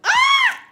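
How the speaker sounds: sudden loud, very high-pitched shriek; high squeal. Real, not acted. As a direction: let out a piercing squeal amid laughter. Squeals amid laughter while telling story.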